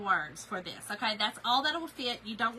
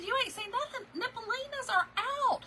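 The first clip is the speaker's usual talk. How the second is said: excited gossip; 13 semitones higher than usual. Retold or imitated. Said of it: "I said".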